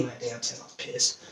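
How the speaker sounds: whispered, annoyed aside; a soft, annoyed aside; muttered. Real, not acted. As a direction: grumble it under your breath. Bad test score.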